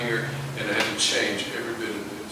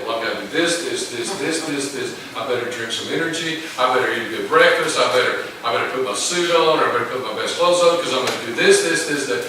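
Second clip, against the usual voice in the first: mock frantic to-do list, getting louder; hurried busy voice; 5 dB louder than usual. Preacher mimics a rushed morning, pushing louder. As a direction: rattle off the chores like a busy man in a rush. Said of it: "How often do we start the day?".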